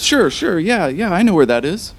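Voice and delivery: high-pitched eager helper agreeing; eager Tobin. Acted. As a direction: nod along, keen to please.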